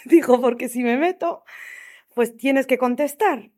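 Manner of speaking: words spill out through a laugh. Real, not acted. Telling listeners why she skimmed messages, laughing. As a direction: laugh at her own excuse, pushing it out. Laughing while retelling her thoughts.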